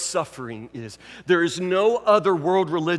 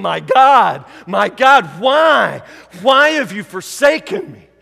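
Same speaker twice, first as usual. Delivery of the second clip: loud pleading cry of abandonment; anguished cry; 7 dB louder than usual. Retold or imitated. Jesus calling out to God from the cross, loud and pleading. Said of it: "he calls him".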